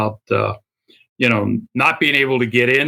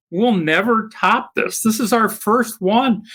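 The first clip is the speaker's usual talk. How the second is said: higher, slow amazed; awed; 7 semitones higher than usual. Retold or imitated. Committee members recall the event in slow awe. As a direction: savour each word in wonder. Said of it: "it was just".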